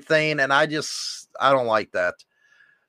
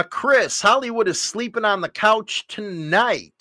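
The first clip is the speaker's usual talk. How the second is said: ordinary delivery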